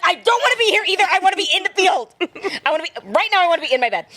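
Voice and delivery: high, fast whiny complaint; mock meltdown, tumbling fast; upset pleading. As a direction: rush out a desperate whine. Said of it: "I'm like".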